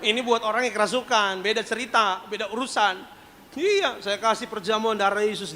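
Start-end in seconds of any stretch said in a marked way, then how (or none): none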